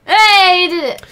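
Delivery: excited. Real, not acted. Cheering the contest winners.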